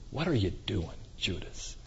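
hushed, almost breathless whisper; soft whisper calling Judas back; whispered plea. Acted. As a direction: whisper it as if only Judas hears. Jesus's inner plea to Judas.

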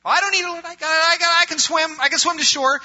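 high, insistent boast of a stubborn swimmer; proud drowning man refusing help, insistent. Pretend. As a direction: brush off the rescue, high and cocky. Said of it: "and you're fighting Him".

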